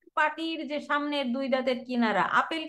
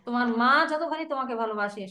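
normal talking voice

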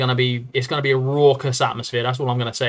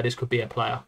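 much softer, to himself; quiet; 3 semitones lower, 9 dB quieter than usual. Real, not acted. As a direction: murmur it softly. Repeats the musing to himself.

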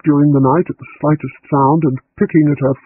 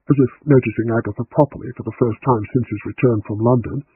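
usual speaking voice

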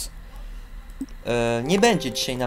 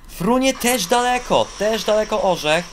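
much higher, louder thrilled call; delighted sports commentator; excited commentary; 9 semitones higher, 4 dB louder than usual. Real, not acted. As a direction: cheer the jump as it flies. Commentating a long jump.

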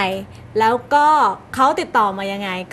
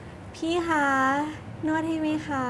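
soft, slow, higher coaxing offer; honeyed voice; 4 semitones higher, 8 dB quieter, slower than usual. Shown as a demonstration. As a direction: purr it sweetly and slowly. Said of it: "เดี๋ยว[name]ลองดูนะคะ".

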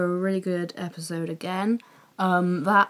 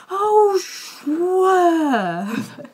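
high, loud yelp at the TV; mock shriek of a terrified viewer; imitated panicked yell; 10 semitones higher, 6 dB louder than usual. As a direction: scream it as if the lorry is coming at you. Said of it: "But, like, more over the top than that".

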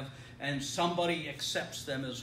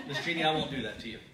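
usual speaking voice

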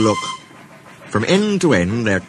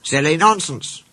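higher-voiced mild grumble; grumbling; 6 semitones higher than usual. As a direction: grumble it as a disgruntled little engine. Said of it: "grumbled Mike".

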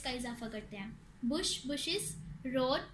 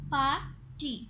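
"Party" split in two with a clear gap; "party" counted out in two beats; syllable by syllable; 5 dB louder, much slower than usual. Shown as a demonstration. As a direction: say "party" as पार, pause, टी. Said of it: "इसके टू syllables हैं".

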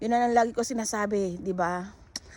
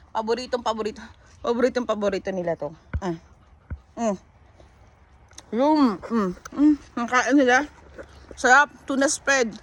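normal talking voice

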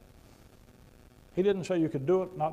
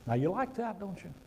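normal talking voice

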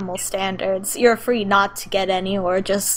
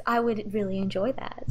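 soft and smooth; 8 dB quieter than usual. Acted. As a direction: purr it charmingly. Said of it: "he says".

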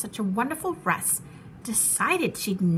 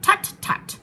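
dismissive tut-tut; 9 semitones higher, 4 dB louder than usual. Acted. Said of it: "answered Maisie".

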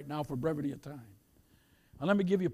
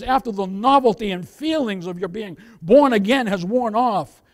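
forceful, emphatic sermon delivery; preaching; 2 semitones higher, 9 dB louder than usual. Real, not acted. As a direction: preach it out to the congregation, punching key words. Preaches to congregation.

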